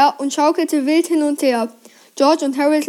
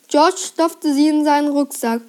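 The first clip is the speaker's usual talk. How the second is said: ordinary delivery, nothing marked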